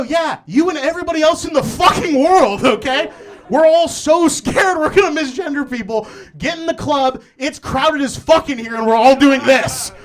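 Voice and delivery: loud laughing rant; exasperated rant. Retold or imitated. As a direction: lay it on thick, fed up but laughing. Ranting back at mom.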